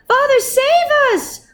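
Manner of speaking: put-on high, frightened children's cry. A narrator's reading. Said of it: "the children cried out".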